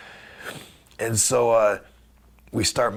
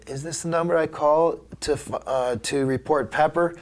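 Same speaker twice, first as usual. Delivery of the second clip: lightly voiced little girl, higher pitched; 6 semitones higher than usual. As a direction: imitate a young girl on the phone, lightly. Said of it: "It's a little girl this time".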